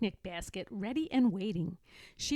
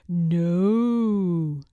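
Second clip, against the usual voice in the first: grandfather bear voice; 3 semitones lower, 9 dB louder than usual. A narrator's reading. Grandfather character voice.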